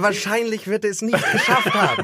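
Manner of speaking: slow, high, laughing delivery; amused spoiler, said laughing. Real, not acted. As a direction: draw it out through laughter. Joking about the known ending.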